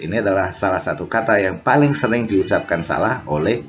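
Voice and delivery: ordinary talk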